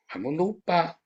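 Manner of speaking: ordinary talk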